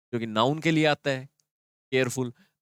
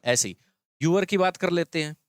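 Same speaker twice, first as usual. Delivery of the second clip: ordinary talk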